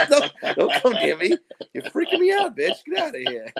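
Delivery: loud, laughing recoil, acted out; freaked-out protest. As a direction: act out a creeped-out refusal. Acting out being freaked out.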